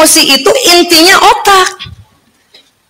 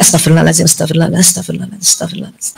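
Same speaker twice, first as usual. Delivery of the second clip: fading istighfar; 10 semitones lower, 6 dB quieter, much faster than usual. Shown as a demonstration. Calming anger by repeating istighfar.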